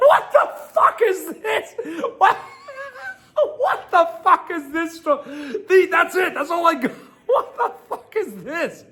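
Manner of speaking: very high, squeaky words through helpless laughing; real hysterical laughter. Real, not acted. Streamer reacting to the absurd ending, hysterical laughter. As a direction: crack up, barely getting the words out.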